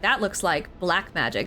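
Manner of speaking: normal talking voice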